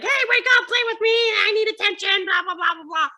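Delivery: put-on whiny high child nagging everyone awake; pestering little kid. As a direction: play the kid begging for attention. Son climbing in, poking people.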